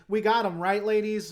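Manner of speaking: loud, showy mock boast; theatrical. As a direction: play it big, imitating a stagey victory call. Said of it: "remind me of a, a theatrical".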